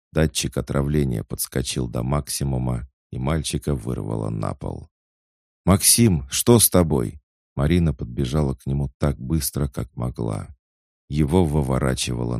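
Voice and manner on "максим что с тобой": louder, higher worried call; alarmed. Acted. Марина rushes to the sick boy, alarmed. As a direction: call out in sudden concern.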